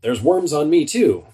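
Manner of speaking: high yelp of alarm; startled exclamation. Shown as a demonstration. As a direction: jump up in pitch, startled. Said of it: "Or an exclamation".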